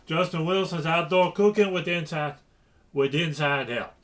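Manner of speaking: put-on playful Cajun accent, lightly done; slight Cajun accent. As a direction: read the title with a hint of Cajun. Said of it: "I can do a slight Cajun accent".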